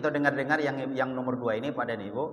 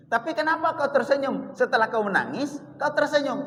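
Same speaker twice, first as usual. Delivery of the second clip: puzzled question; 8 semitones higher than usual. Retold or imitated. Aisyah questions Fatimah, high.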